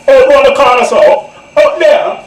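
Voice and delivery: loud, high Jamaican patois. Retold or imitated. Jamaicans giving a newcomer directions, loud patois. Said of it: "Jamaican patois".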